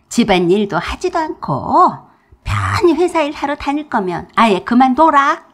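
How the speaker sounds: mother-in-law yelling at daughter-in-law; yelling order. A narrator's reading. Mother-in-law keeps yelling at her.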